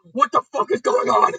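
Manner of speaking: high, loud, hammed-up panic; mock freak-out yelled at the sky; panicked shout. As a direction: yell it as the monsters turn real. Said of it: "he starts panicking".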